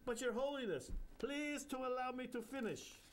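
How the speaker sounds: put-on high, sing-song Indian accent; jovial Indian guru. Bapucharya teasing the narrator, Indian accent. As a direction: joke like a cheeky holy man.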